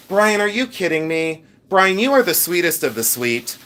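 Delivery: ordinary delivery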